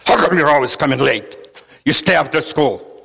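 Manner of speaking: loud, scolding mean teacher; strict teacher telling off a latecomer. Acted. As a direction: bark it like a mean schoolteacher. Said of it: "the mean teacher says".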